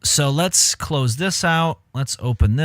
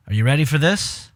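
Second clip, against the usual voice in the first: usual speaking voice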